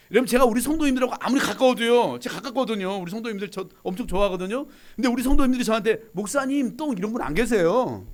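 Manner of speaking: loud, high, fervent preaching; preaching at full force. Acted. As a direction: rouse the room with a punchline. Preaching loudly.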